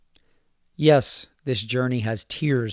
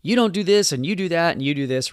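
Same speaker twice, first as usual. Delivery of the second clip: accusing lash-out; 5 semitones higher, 3 dB louder than usual. Retold or imitated. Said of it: "Instead of lashing out and saying".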